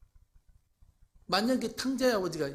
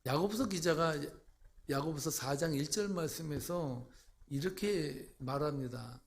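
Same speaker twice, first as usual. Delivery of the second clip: ordinary delivery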